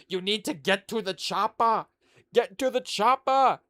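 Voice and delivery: put-on Austrian action-hero accent, loud on the last line; Arnold. Co-host mimics Yelena sounding like Arnold, Austrian accent. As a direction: bark it like Schwarzenegger. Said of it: "borderline Arnold".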